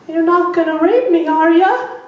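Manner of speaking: high mock old lady voice; frightened elderly woman, high. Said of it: "she turns around like this".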